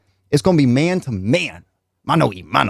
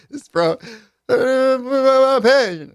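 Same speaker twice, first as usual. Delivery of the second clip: higher, slow starstruck gush; cheesing fan; 10 semitones higher, much slower than usual. Retold or imitated. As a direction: drag it out, grinning like a fan. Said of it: "I was cheesing".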